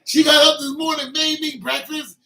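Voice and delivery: high, loud amazed echo; loud, high amazed echo of a friend's news; amazed repeat. Retold or imitated. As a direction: say it again like you can't believe it.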